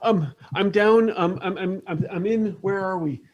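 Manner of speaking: high, halting search for words; flustered Beth. Acted. Beth tries to tell Roy her location, flustered.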